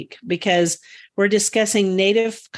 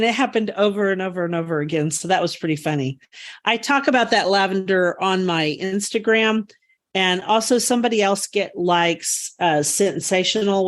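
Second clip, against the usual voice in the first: ordinary talk, nothing marked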